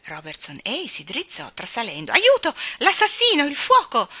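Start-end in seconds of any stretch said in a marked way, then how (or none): 2.1-4.1 high panicked yells, much louder; startled shouting. Acted. Gardener jolted awake by Jane, shouting in panic. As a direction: jolt awake and yell for help. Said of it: "gridò agitando le braccia".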